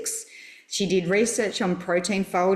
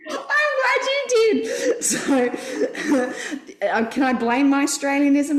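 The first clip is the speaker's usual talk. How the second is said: squeaky high laugh through words; 8 semitones higher, 4 dB louder than usual. Real, not acted. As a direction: giggle while apologising. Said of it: "giggling away".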